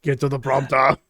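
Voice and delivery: mock Austrian accent, not shouted; Arnold Schwarzenegger. Co-host echoing the riff, Austrian accent. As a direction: imitate an Austrian action hero. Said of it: "they're like Arnold Schwarzenegger in".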